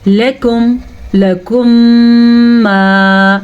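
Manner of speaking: لکم with brief "la", then hum held into ما; short lam. Shown as a demonstration. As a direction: keep لکم's first syllable short, stretch ما. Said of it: "لام کو لمبا نہیں کرنا".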